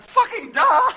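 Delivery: high, loud mocking yelp; loud incredulous mockery; mocking outburst. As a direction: yell it as the blindingly obvious. Mocking outburst at psychic fraud.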